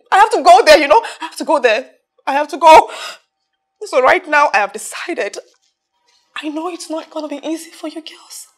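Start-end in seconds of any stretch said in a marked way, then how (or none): 0.1-2.9 loud, emphatic, agitated repetition; urgent insistence. Acted. Telling friends the business needs attention, urgent insistence. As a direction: insist you must leave.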